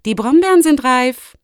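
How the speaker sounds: high, loud announcement; young fox girl. Acted. Fox girl announces ripe berries. (hohe, laute Fuchsmädchenstimme)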